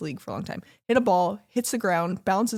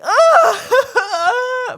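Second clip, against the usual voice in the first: loud, much higher laughing cry; laughing wail; 12 dB louder than usual. Retold or imitated. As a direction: burst out in a laughing howl. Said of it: "I would have been like".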